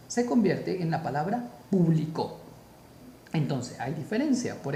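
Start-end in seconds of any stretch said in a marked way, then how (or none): 1.7-2.4 público said as pu-bli-CÓ; stress on last syllable. Shown as a demonstration. Teacher showing the aguda form, público as pu-bli-CÓ. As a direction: move the stress in público to final CÓ. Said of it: "si le colocamos acento en la última sílaba".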